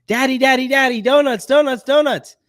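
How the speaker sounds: high, loud imitation of an excited child; excited young daughter. Daughter running in to dad this morning, imitated excited squeal. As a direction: chant it like a kid bursting in. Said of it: "she runs in this morning".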